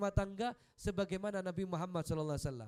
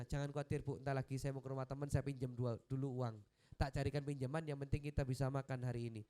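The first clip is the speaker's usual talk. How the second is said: reassuring husband; 7 semitones lower, faster than usual. Retold or imitated. Calm husband reassuring his wife he will borrow money, low and gentle. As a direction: soothe her, no worry.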